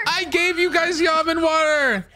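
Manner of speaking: high, screamed protest at the monsters; frantic, high-pitched shout; panicked yelling. Real, not acted. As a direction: wail it as they close in. Pleads with monsters while chased.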